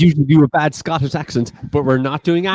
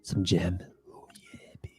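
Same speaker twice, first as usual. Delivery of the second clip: mock sultry whisper; 10 semitones lower, 12 dB quieter than usual.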